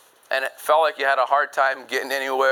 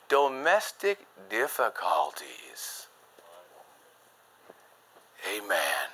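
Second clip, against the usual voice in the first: hushed grave; 9 semitones lower, 8 dB quieter, slower than usual. Acted. Preacher drops voice on key point.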